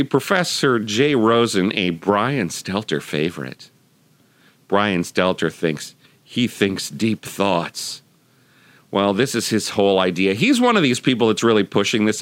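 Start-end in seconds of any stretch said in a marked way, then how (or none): 4.7-8.0 mocking. Real, not acted. Mocking Stelter's deep thoughts.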